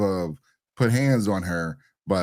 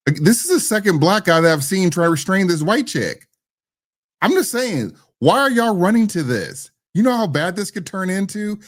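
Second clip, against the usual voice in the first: raised, higher and louder, getting heated; worked-up rant; 9 semitones higher, 5 dB louder than usual. Real, not acted. Co-host ranting to his partner about men stepping in, worked up and loud. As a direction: push it up like you can't believe it.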